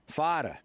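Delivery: "fada" with a hard D in place of TH; Brooklyn fada. Shown as a demonstration. Demonstrating the old Brooklyn accent to the interviewer, hard D. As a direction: say "fada", not father, punching the D. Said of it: "you get to those kind of hard Ds".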